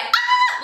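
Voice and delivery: very high, small mock scream; half-hearted mimicked scream. Showing viewers how she screamed, half-hearted high shriek. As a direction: try to mimic a shriek without full volume. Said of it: "I can't even do it now. I can't mimic it".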